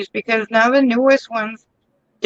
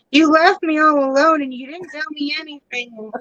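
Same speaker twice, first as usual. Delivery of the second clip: whiny complaint; 5 semitones higher than usual. Retold or imitated. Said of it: "she's like".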